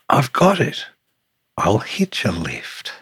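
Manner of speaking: high, loud burst of a bright idea, then settling; excited exclamation. Acted. Tiny snail finds a way to travel, excited cry. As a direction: exclaim it like a sudden discovery.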